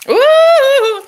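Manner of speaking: high, loud, sustained squeal; loud giddy cry; excited whoop. Real, not acted. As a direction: let out a long piercing ooh.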